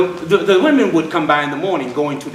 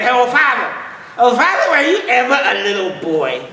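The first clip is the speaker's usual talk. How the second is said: put-on eager little boy; 7 semitones higher than usual. Little boy begging old father for a story, high and eager. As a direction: beg like an excited kid.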